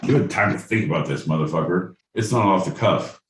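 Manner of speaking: ordinary talk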